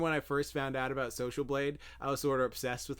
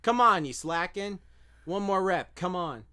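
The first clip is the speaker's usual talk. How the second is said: loud, high gym hype man; hype coach voice; 6 semitones higher, 4 dB louder than usual. Shown as a demonstration. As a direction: pump them up for one more rep. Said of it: "affirmations where I, like, tell you".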